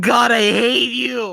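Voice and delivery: loud, drawn-out cry of hatred; exasperated shout. Real, not acted. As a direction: shout it out, stretching the words. Outburst opening the show.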